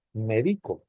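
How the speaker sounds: "médico" said as me-DI-co, stress on DI; stress on middle syllable. Shown as a demonstration. Teacher shows the word without tilde, "médico" stressed as me-DI-co. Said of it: "También es válido y no lleva tilde".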